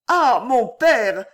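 high, loud, slow cry of relief. A narrator's reading. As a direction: throw it out, stretching each word. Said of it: "s'écria-t-elle". (cri aigu et fort, lent, de soulagement)

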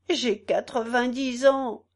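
lightly voiced old man; a hint of an old man's quaver. A narrator's reading. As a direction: plead as an aged petitioner. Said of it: "chevrota un vieillard".

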